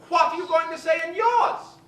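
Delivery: high diva voice shouting the question; Betty Davis demanding. Retold or imitated. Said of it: "Betty Davis".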